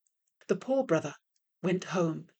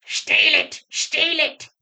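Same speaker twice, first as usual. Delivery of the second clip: much higher, louder shrill devil chant; devils shouting; 10 semitones higher, 6 dB louder, slower than usual. A narrator's reading. Small devils urging a theft, shouting high and loud. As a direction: yell it like a riotous mob. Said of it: "The smaller devils all started shouting".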